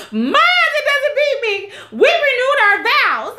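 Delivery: very high, squeaky hammed-up wail; mock Shannon. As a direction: send it up like a whiny diva. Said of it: "Shannon's like".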